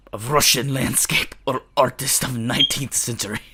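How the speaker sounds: put-on a faint, half-hearted Russian accent; mock Russian accent. Player reading the visual novel to viewers, in a faint Russian accent. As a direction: slips into a light Russian accent, then gives up. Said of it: "I can't do the accent".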